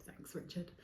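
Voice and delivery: dry whispered sarcasm; a deadpan breathy "thanks a lot"; sarcastic thanks. Retold or imitated. Replying to a doubting friend, dry whispered sarcasm.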